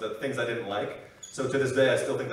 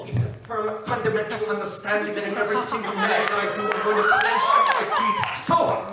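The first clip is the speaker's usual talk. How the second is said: higher, put-on actor's voice building loud; Christoph Waltz; 7 semitones higher, 4 dB louder than usual. Actor declaring an intense conviction, put-on voice rising to a shout. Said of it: "Christoph Waltz".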